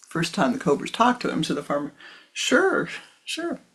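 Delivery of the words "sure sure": much higher, hasty startled yes; startled agreement. Acted. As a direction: caught off guard, agree fast. Farmer startled by talking cobra.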